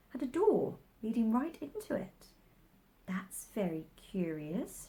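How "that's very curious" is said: soft, slow musing; thinking aloud. A narrator's reading. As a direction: murmur to yourself. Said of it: "she thought".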